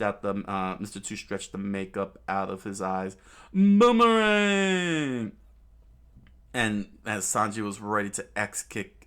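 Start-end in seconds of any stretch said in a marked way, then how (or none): 3.5-5.4 high, loud, drawn-out shout; theatrical battle cry; attack call. Acted. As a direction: hurl the word out, stretched long. Villain calls out attack name.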